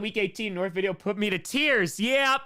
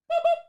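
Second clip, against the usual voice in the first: squeaky high boop, louder; 4 dB louder than usual. Acted. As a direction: toy squeak for the donation. Donation boop sound effect.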